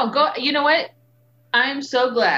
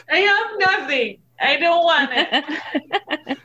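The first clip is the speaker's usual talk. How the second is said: laughing mock panic; 7 semitones higher than usual. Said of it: "And I'd be like".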